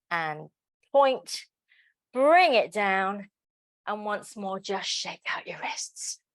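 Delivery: normal talking voice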